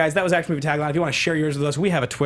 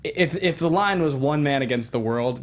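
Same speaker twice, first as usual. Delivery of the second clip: ordinary talk, nothing marked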